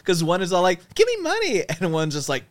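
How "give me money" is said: squeaky, high, bubbly plea; high girly voice. Pretend. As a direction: beg sweetly in a girly squeal. Voicing Barbie tip jar.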